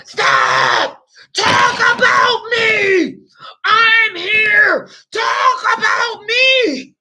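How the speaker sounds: high, loud, slow whining shout; mock his demanding cry for attention; imitating him. Mocking the man who wanted attention, high pleading shout. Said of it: "he was like".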